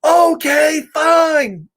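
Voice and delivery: high, loud fed-up yell; screaming. Acted. Pigeon finally giving in to the flies, screaming. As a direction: scream it at the top of your lungs. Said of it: "he screams at the top of his lungs".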